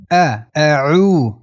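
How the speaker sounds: 'اعو' with a slow, held oo; drawn-out long vowel. Shown as a demonstration. Teacher shows the natural madd, vowel held slowly. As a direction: stretch the oo of 'اعو' for two counts.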